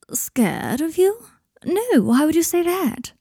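a hint of a cagey friend playing innocent; Blaise's voice. A narrator's reading.

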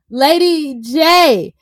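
drawn-out, high mock announcer call; game-show announcer. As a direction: call up the next contestant, stretching every word. Calls up next speaker like game show.

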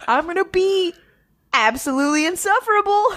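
talking through real laughter; laughing. Real, not acted. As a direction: laugh while admitting it. Laughing at own fandom.

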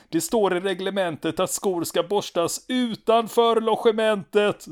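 high, loud, raging complaint; furious shout. Retold or imitated. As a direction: yell at the lieutenant in a fury. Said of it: "står en rasande Stanneman och skriker".

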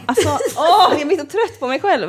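high, laughing groan of self-despair; laughing at herself. Real, not acted. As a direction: laugh through your frustration. Laughing at her own naivety. (skrattar uppgivet åt sig själv)

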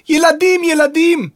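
very high yelled repetition; full-throated shout to a deaf man. A narrator's reading. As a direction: yell it at the top of your voice. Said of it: "צעקה האישה במלוא גרונה".

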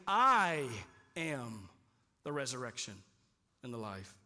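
solemn emphatic claim, word by word. Retold or imitated. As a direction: land every word apart, fading into awe. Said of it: "Jesus is being emphatic".

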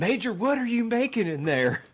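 high, loud backwoods holler; hillbilly voice. Pretend. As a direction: holler it like a swamp man. Acted southern backwoods voice.

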